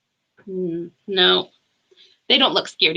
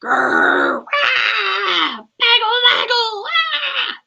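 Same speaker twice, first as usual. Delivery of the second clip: monster roar; 12 semitones higher, much slower than usual. Acted. Monster roaring to scare the boy Sam. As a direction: give it all you've got, like a monster. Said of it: "the monster gave it all he had".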